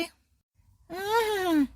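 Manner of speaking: a short, drawn-out acted sob; mock whimper. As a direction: let out one long tearful whimper. Scolded daughter-in-law whimpers. (ನಾಟಕೀಯವಾದ ಬಿಕ್ಕಳಿಕೆ)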